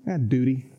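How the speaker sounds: flat, dutiful mock answer; dutiful shrug. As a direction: say it like a chore. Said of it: "And I go".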